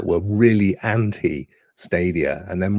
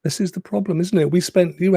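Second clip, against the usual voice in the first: ordinary talk, nothing marked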